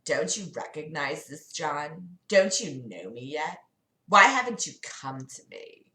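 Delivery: put-on soft, low take on a Valley Girl Satan; lightly voiced Valley Girl devil, kept quiet. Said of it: "we're just gonna do Valley Girl Satan".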